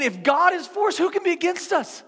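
preacher proclaiming the line after the bear story; preaching climax. Acted.

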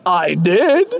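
smug little laugh; proud laugh. Acted. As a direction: fan bragging to friends. Proud fan answering friends.

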